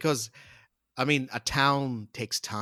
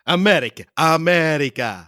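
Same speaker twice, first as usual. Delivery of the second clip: put-on American accent; 4 semitones higher, 13 dB louder than usual. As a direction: belt out America in a mock Yankee voice.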